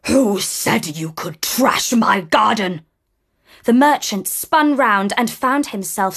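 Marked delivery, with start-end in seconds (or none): 0.1-2.8 growled warning. Acted. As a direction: growl it like a beast. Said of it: "a growling voice".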